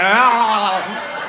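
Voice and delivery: loud drawn-out "ah" cry; comic melting wail. Acted. Comic telling of his melting like a snowflake, loud wail. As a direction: wail out a long, loud melt.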